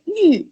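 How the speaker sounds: YU as a single falling strike; fourth tone. Shown as a demonstration. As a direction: say YU in one sharp downward stroke. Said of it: "like, a single strike".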